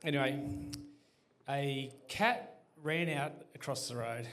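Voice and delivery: normal talking voice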